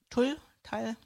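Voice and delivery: TULL tried twice with different vowels, questioning; trying out pronunciations. Shown as a demonstration. Co-host unsure of the name, "Tull" tried out as short questions. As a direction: test the vowel in "Tull", first "tool"-like, then "tall"-like. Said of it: "musst du mir wieder helfen bei der Aussprache".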